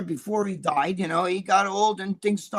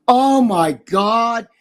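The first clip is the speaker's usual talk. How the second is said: exasperated; 3 semitones higher, 9 dB louder, much slower than usual. Real, not acted. Venting about daughter's smoking.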